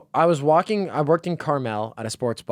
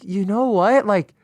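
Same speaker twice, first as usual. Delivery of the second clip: building mock outrage; 11 semitones higher, 5 dB louder than usual. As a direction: pitch climbs higher and higher, louder throughout. Mock rant about political books.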